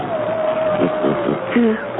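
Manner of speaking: high far-off phantom laugh; eerie ghostly laugh. Acted. Ghost mocks the captain with a high eerie laugh.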